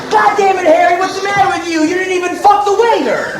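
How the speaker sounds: high, loud nagging yell; scolding outburst. Acted. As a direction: berate Harry in a shrieked tirade. Acted scolding of Harry at restaurant.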